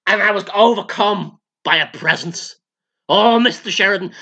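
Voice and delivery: ordinary talk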